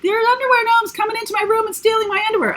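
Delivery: high, fast squeaky cartoon kid; high-pitched fast cartoon kid voice; Cartman impression. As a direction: rattle it off like a panicked cartoon boy. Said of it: "Cartman sees them and he's like".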